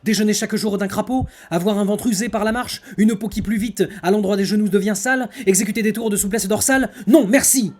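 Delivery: loud, high, grand theatrical declamation; hammy stage actor. Acted. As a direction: boom it out like a hammy tragedian. Declaims the non-merci tirade.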